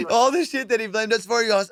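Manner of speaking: normal talking voice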